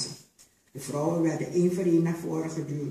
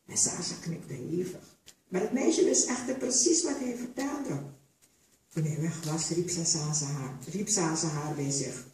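ordinary delivery

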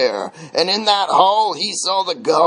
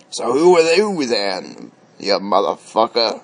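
low-pitched, pointed challenge; demanding; 6 semitones lower than usual. A narrator's reading. Dwarf challenges Thor's right to object, low demand. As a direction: demand it low, leaning on the insult. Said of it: "demanded Elvis".

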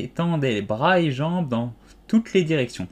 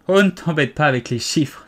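louder, higher interruption; irritated cry; 4 semitones higher, 5 dB louder than usual. A narrator's reading. Duchess cutting off Alice's sums, crying out impatiently. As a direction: cut in with impatience. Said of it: "s'écria la duchesse".

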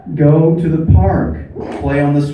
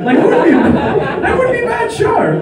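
excited; faster than usual. Real, not acted. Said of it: "excited self-remark".

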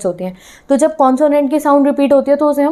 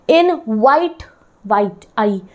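usual speaking voice

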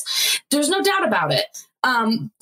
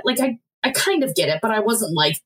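normal talking voice

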